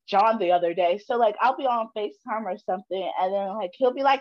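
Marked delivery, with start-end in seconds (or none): none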